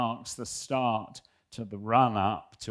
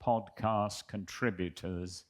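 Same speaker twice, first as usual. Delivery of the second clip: reading a fan letter aloud, a little formal; 3 dB louder than usual. Acted. Reading a listener's letter to the hosts, amused.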